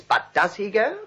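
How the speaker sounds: usual speaking voice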